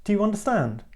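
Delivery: high-pitched question on "understand"; question inflection. Shown as a demonstration. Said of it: "that inflection can turn any word or sentence into a question".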